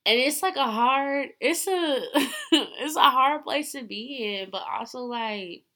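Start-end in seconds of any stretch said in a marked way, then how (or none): 0.1-4.5 laughing. Real, not acted. Emotional, laughing through it.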